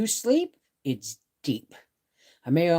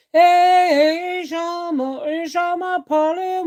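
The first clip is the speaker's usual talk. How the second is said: high, loud calling out names; bright high hello to arriving chatters; singsong greeting; 7 dB louder, slower than usual. Real, not acted. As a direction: hail each viewer in a lilting call.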